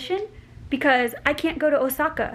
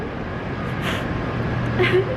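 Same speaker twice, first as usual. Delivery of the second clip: tearful breathy laugh; 8 semitones higher than usual. Real, not acted. Teary relief after emotional news.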